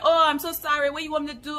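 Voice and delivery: put-on Nigerian accent. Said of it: "do my best Nigerian accent".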